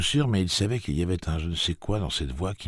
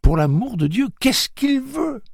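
supplique désespérée; 7 dB louder than usual. A narrator's reading. Susanna insiste, désespérée.

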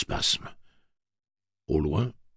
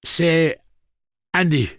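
loud, higher-pitched call; urgent projected voice; raised voice; 12 semitones higher, 9 dB louder, slower than usual. A narrator's reading. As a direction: call it out over the din. Eddie calls over the din.

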